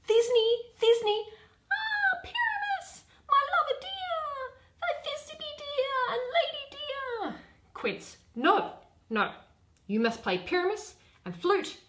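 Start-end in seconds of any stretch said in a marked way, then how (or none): 0.1-7.4 much higher, tiny falsetto lady; monstrous little voice. Acted. Bottom shows how he'd play Thisbe, high and squeaky. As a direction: squeak it like a swooning girl. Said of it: "I'll speak in a monstrous little voice".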